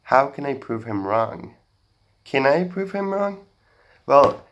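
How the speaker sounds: ordinary talk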